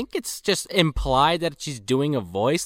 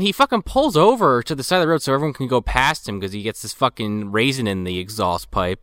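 usual speaking voice

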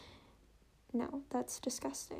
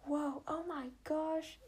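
drawn-out, higher mock gasp of amazement; imitating cousins; 4 semitones higher, slower than usual. Imitating her cousins reacting to the phone jump, slow and higher. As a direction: stretch the whoa like startled cousins. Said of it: "you can hear my cousins in the background".

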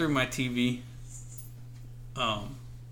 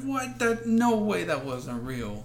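amazed disbelief; 8 semitones higher, slower than usual. Retold or imitated. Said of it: "It's like".

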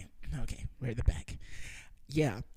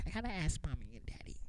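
mocking childish girl voice; 5 semitones higher, 5 dB quieter, faster than usual. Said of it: "she didn't say it like that".

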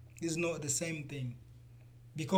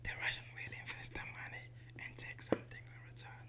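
hushed whisper about politics; 9 dB quieter than usual. Real, not acted. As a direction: whisper it so nobody hears. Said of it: "why are you whispering again?".

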